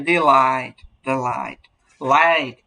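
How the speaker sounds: Deloitte with light first syllable, heavy drawn-out light; stress on second syllable. Shown as a demonstration. Teacher showing students an iamb, weak then strong Deloitte. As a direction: lean hard on light in Deloitte. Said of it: "এলটা কি অনেক ভারী হচ্ছে".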